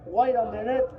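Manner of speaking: put-on high boyish call, rising at the end; fat boy calling. A narrator's reading. Fat boy calls again to the fair boy, high boyish voice.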